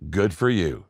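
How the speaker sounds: low, understated sarcastic approval. Retold or imitated. Preacher voices James answering a boastful believer, mildly sarcastic. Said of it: "uses a little bit of sarcasm".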